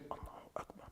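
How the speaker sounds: barely voiced Allahu Akbar, lips moving; whispered takbir. Shown as a demonstration. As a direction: whisper the takbir just to yourself. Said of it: "You say".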